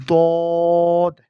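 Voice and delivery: "দদ" loud, rounded, vowel held long; demonstrated Arabic letter. Shown as a demonstration. Teacher models the letter, loud drawn-out "দদ". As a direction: round and stretch "দদ" forcefully. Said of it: "আমি যেভাবে বলেছি".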